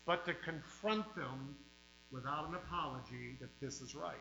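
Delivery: fiery pulpit preaching, hammering each line. Real, not acted. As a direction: revival preacher warning the flock. Preaching at full force.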